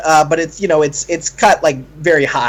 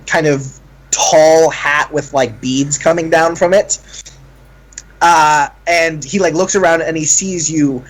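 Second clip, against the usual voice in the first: ordinary delivery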